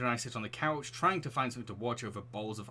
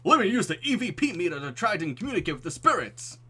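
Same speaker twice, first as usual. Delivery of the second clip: hammed-up ghost hunter; 7 semitones higher, 9 dB louder than usual.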